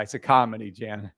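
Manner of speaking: ordinary talk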